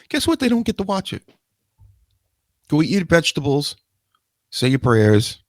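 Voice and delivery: a high, put-on strict parent voice; a high mocking parent's taunt. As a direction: sneer it like a smug parent laying down the law.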